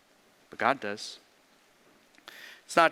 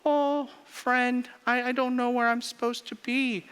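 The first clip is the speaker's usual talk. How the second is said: lightly voiced lost little light, higher and pleading; lost light talking; 8 semitones higher than usual. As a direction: play a confused lost beam of light, higher. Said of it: "it says".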